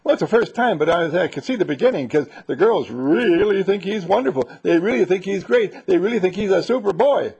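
an exasperated neighbor lady ranting to the dad; high, loud, indignant lady's voice; fussy neighbor lady. Acted. Neighbor lady keeps complaining.

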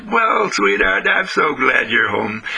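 put-on slow, tender welcome to a child; doting mother voice, unhurried; smiling mother. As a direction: stretch it out soothingly, with a smile. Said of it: "the mother smiles at the child".

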